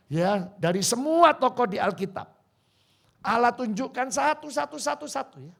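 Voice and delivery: loud, high, ringing preacher's call; preaching at full force. Acted. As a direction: proclaim it to the congregation. Preacher building intensity. (berkhotbah dengan lantang dan bersemangat)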